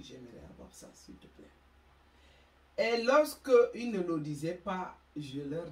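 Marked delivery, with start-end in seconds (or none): 0.0-1.5 much softer, almost whispered polite request; polite child request. Shown as a demonstration. Mother teaching her child to ask, soft and polite. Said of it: "on dit".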